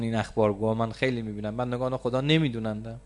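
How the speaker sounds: normal talking voice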